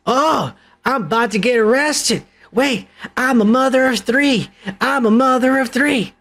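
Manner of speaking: mock her begging, hammed-up; mocking the woman.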